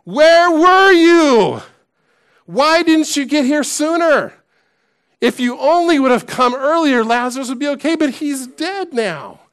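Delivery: high, drawn-out indignant reproach; acting out a grieving woman's scolding, loud at first; angry scolding. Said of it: "see her just scolding with her finger out".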